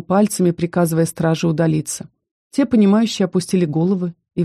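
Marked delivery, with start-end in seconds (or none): none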